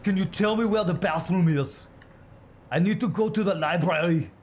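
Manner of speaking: loud, high, hammed-up Austrian accent; Arnold Schwarzenegger. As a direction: play an Austrian tourist with a mock accent, loudly. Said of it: "so you speak like Arnold".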